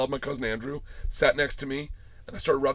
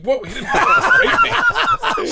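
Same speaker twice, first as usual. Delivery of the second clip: loud, high-pitched laughing protest; comic, drawn-out squeal of protest; shocked denial; 11 dB louder, much slower than usual. Retold or imitated. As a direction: yelp the denial through laughter. Acts out shocked denial to daughters.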